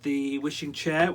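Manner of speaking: ordinary delivery, nothing marked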